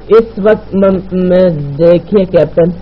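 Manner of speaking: flustered stammer. Acted. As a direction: stall nervously, tripping over the words. Woman flustered behind closed door. (گھبرا کر ہکلاتے ہوئے)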